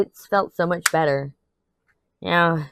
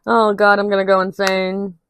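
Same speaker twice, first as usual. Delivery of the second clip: exasperated; 3 semitones higher, 4 dB louder than usual. Real, not acted. Exasperated over roommate.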